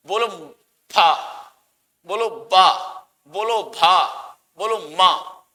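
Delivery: syllables फा, बा, भा, माँ said crisply with lips closing; lip sounds demo. Shown as a demonstration. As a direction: pronounce each lip sound clearly for the class. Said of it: "अब बिना होठ मिलाए पा बोल के दिखाओ".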